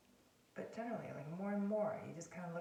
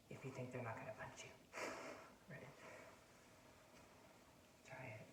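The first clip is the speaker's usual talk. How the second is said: ordinary talk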